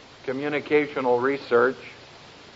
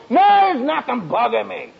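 higher, louder, huffy insistence; huffy mock denial; 7 semitones higher, 3 dB louder than usual. Husband denies to his wife, hammed-up huffy insistence. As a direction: act the husband sulkily insisting he's fine.

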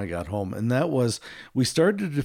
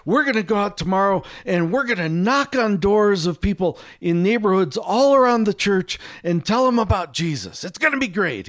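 bright, much higher and louder, gushing; overly enthusiastic; 9 semitones higher, 4 dB louder than usual. Retold or imitated. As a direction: oversell it like an eager team captain. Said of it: "a little too much enthusiasm".

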